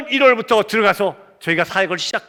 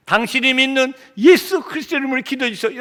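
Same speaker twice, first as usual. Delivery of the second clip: higher, impassioned request for prayer; fervent plea; 6 semitones higher than usual. Retold or imitated. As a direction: plead with rising preacher's fervor. Plea repeated with preaching fervor.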